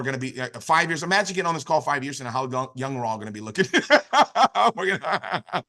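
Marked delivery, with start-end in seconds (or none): 3.7-4.7 a burst of high, loud laughter; real laugh. Real, not acted. Joking to the team about looking younger, bursting into loud laughter. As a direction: laugh out loud at your own joke.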